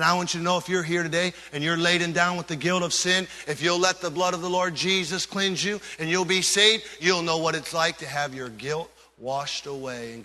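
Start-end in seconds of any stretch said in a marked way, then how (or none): none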